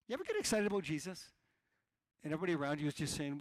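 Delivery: ordinary delivery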